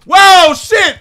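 very high, loud startled yell; startled shout. Real, not acted. Gamer startled by an enemy. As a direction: jump and shout it. Surprised in game.

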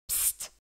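soft hissed psst; whispered psst. A narrator's reading. Cat warning the girl, whispered psst. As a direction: get her attention in a hush. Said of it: "chuchota-t-il".

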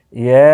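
"ইয়ে" with its vowel drawn out long; elongated vowel. Shown as a demonstration. Teacher shows learners the long letter, "ইয়ে" held long. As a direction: stretch the end of "ইয়ে" evenly. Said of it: "এখানে ইয়াকে টানতে হবে".

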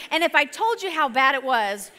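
ordinary delivery

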